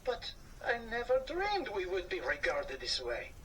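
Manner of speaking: a touch of hurt in a little mouse voice; hurt Anatole. Acted. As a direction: say it like your pride is hurt. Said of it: "said Anatole".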